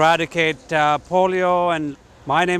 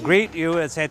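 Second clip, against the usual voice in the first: ordinary talk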